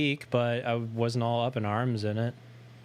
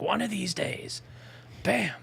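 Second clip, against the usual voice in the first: high, slow blustery threat; Honeymooners impression; 7 semitones higher, slower than usual. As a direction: bellow it like a sitcom husband. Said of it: "a scene from the honeymooners".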